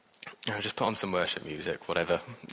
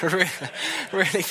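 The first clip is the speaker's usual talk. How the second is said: a high, loud, laughing "really"; laughing emphasis; 9 semitones higher, 4 dB louder than usual. Real, not acted. Preacher laughing at his own frustration, high and loud. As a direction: burst up into "really" through a laugh.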